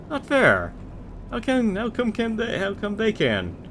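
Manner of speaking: high whining villain voice; whiny dark lord. Acted. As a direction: whine like a sulking overlord. Skeleton whining about skeletons.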